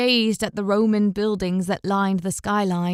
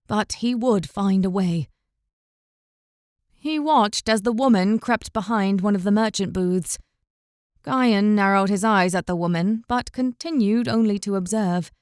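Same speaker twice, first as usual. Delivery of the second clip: ordinary delivery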